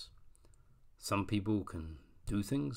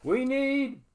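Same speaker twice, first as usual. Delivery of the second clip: high loud slow call; calling for help; 13 semitones higher, 5 dB louder, slower than usual. Acted. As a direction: cry out for help. The cry goes up.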